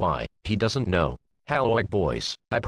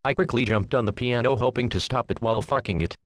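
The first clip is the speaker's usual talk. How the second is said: plain reading voice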